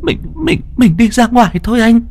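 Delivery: frail terrified wife. A narrator's reading. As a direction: stammer it out in terror, barely able to speak. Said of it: "Cô thều thào". (giọng vợ thều thào, sợ hãi)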